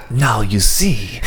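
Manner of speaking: slow hushed documentary narrator; lightly voiced wildlife narrator; nature documentary. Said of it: "Just go full David Attenborough".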